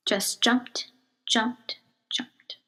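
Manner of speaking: dying away to a whisper; fading to a whisper. A narrator's reading. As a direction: let the words fade away. Narrator fading repetition.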